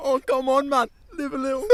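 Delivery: put-on Caribbean accent. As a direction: cajole in a mock Caribbean accent. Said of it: "in a Caribbean accent".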